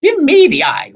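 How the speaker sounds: high, loud, desperate wail. A narrator's reading. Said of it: "Wasp wailed".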